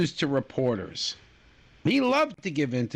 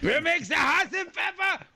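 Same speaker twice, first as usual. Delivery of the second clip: put-on horrible French accent; 12 semitones higher than usual. Cohost mocks the French leader in a bad French accent, shouting. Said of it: "that was a horrible French accent".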